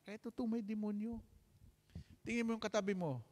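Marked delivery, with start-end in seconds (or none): none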